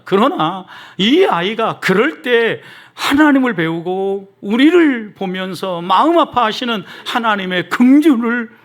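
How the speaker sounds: slow, high, emphatic exhortation; impassioned preaching. Real, not acted. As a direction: stretch each phrase with fervent weight.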